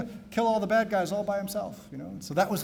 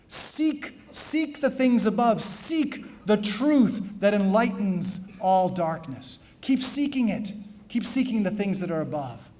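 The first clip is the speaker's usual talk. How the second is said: slow, drawn-out exhortation; solemn slow homily call; preaching; 4 semitones higher, slower than usual. Acted. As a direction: linger on each call to seek. Preaching seek refrain.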